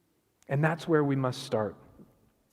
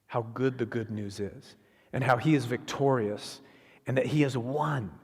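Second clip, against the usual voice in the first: ordinary talk, nothing marked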